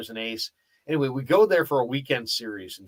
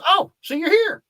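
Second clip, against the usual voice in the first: very high, louder mimicked voice; Tony Gwynn; 6 dB louder than usual. Ballplayer greeting the reporter who showed up early, high-pitched impression. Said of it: "he's in a real high-pitched voice".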